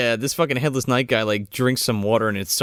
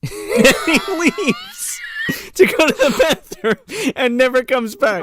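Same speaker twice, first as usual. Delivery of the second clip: helpless laughter breaking up the words; high, squeaky with real laughter; laughing; 11 semitones higher, slower than usual. Real, not acted. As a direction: struggle to finish through laughing. Teller cracking up at the story.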